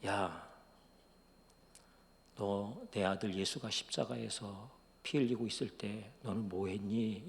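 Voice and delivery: low, soft, slow question; hushed voice of God, unhurried; God asking. Retold or imitated. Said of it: "하나님이 이분에게 이렇게 물으셨대요".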